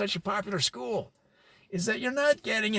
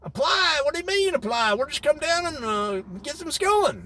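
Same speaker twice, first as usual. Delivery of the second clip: baffled protest; 6 semitones higher than usual. Retold or imitated. Mountain folk scoffing at having to apply. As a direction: push back in disbelief. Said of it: "and they're like".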